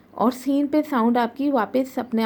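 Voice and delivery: ordinary delivery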